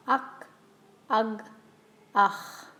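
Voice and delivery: «Ak», «ag», then «ach» with a breathy throat rasp; vowel plus throat sound. Shown as a demonstration. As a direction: slide from «Ak» down to a rasped «ach». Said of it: "Try it with the sound before it".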